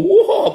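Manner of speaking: loud, drawn-out "waah"; exaggerated spooky howl, clearly joking; mock demon roar. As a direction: roar a long mock ghostly wah.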